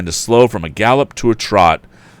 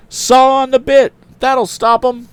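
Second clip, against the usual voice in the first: high, loud commanding shout; 5 dB louder than usual. A narrator's reading. Tom shouting orders to the woman, high and loud. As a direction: bark the order over the noise. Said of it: "shouted Tom".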